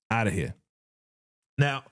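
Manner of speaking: normal talking voice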